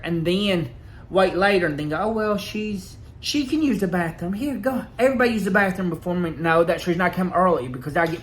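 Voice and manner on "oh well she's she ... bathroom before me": a higher, lightly mocking voice for the coworker. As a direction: imitate her fake-generous tone. Coworker mocked in retold scene.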